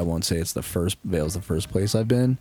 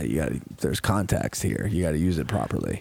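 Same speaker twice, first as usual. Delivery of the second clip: lower, measured dad voice; imitating dad; 3 semitones lower than usual. Imitating dad explaining swearing rules, lower and measured. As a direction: reason it out in a lower register. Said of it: "My dad was more just like".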